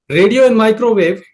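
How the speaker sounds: loud, pointed radio and microwave; loud pointing; emphatic naming. Real, not acted. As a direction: call out the bands on screen. Pointing out bands loudly.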